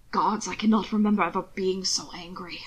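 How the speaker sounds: hushed fury held back; seething whisper. Acted. Husband admitting his anger, a seething whisper. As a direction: whisper the rage through restraint. Hannibal seething at Will's secrecy.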